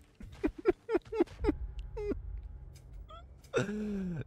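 high-pitched helpless laughter; high laughing fit. Real, not acted. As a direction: laugh until it almost turns to crying. Cracking up at character's outburst.

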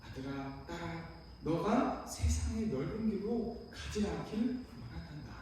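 soft, slow fatherly appeal; hushed, loving voice of Jesus; gentle voice. Acted. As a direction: plead tenderly and quietly, like calling a child. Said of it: "부드러운 음성으로 다시금 우리를 부르신다".

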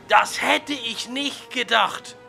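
normal talking voice

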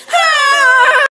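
high, loud squealed "Hi"; mock greeting. Girls greeting the camera in a roast video, loud squeaky "Hi". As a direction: squeal "Hi" like a fake-sweet popular girl.

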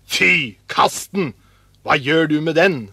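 loud, disgusted dismissal; scornful scolding. A narrator's reading. Older brothers reject the dead bird, scornful. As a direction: tell off a silly little brother.

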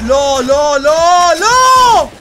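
rising panicked shouts of no. Real, not acted. Gamer losing a fight, panicked shouting. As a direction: beg the game in rising panic.

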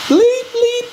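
high, squeaky censor beep; quick high-pitched beep beep; mouth bleep. Pretend. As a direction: bleep out the swear word with your voice.

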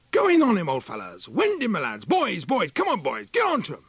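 high, loud calls to the hounds; hunting shout. A narrator's reading. Huntsman shouting his hounds into the otter's holt. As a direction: cheer the pack on from the bank.

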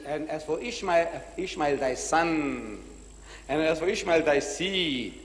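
slow, drawn-out scripture quotation; solemn scripture quoting. Retold or imitated. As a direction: quote holy writ slowly and weightily. Quoting God from the Bible.

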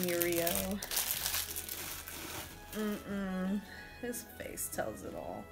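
ordinary talk, nothing marked

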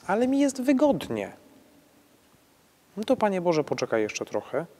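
high, loud whiny put-on voice of a comfy sinner; mock lazy believer. Priest mimics a sinner putting God off, mock whiny. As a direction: act out a believer who'd rather stay comfy.

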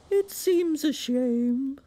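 high, slow storybook walrus oration; grand walrus. A narrator's reading. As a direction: announce it like a pompous walrus. Walrus speaks.

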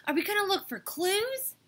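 high, loud, thrilled question; breathless excitement. A narrator's reading. Tiffany hears about the secret journal, thrilled. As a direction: ask it pitched right up, thrilled. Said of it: "Tiffany drew in her breath".

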